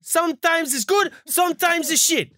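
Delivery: high, slow, mock Spanish-accented coach voice; mock Simeone. Mocking Simeone's reply to his pundit critics, high and slow. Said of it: "That's what I felt like saying to him".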